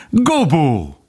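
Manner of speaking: high, drawn cry of recognition. A narrator's reading. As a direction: call the name out high. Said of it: "kiáltotta".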